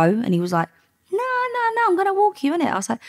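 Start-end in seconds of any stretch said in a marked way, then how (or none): 1.1-2.7 very high dismissive run of no's; mimicking a pushy man; imitated insistence. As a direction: wave the objection away in a high voice. Retold dealer insisting.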